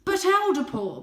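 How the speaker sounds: high, loud objection; father cat protesting to the elder. Acted. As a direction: object urgently. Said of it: "protested Father".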